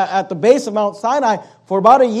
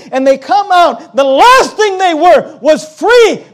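raised, forceful sermon delivery; impassioned preaching; 4 semitones higher, 5 dB louder than usual. Real, not acted. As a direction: drive the point home, punching key words. Preacher building to emphatic point.

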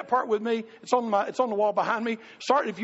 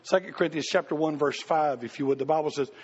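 normal talking voice

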